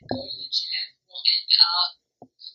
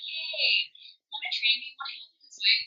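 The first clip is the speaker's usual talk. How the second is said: higher, sugary mock plea; mock sweet fair-weather friends; 6 semitones higher, 5 dB quieter than usual. Mocking old acquaintances who come back asking for help, in a higher fake-sweet voice. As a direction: ask for help in a fake-nice voice. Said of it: "will now start coming back and being like".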